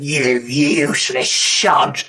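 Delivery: lightly voiced old woman, slowly drawn out. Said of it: "there was an old woman there".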